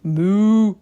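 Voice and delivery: loud moo; cow moo. A narrator's reading. Cow asks to come in, loud mooing. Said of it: "said Cow".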